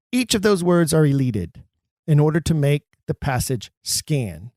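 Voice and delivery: normal talking voice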